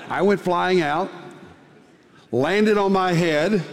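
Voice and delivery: laughing through the words; can't help chuckling at the memory; rueful laugh while retelling. Real, not acted. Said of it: "It wasn't that funny".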